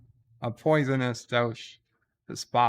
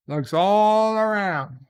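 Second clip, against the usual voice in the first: higher, louder, stretched ALL; drawn-out build-up; 8 semitones higher, 6 dB louder than usual. A narrator's reading. Storyteller building suspense before the punchline, drawn out. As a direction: draw out "all" with a rising swell.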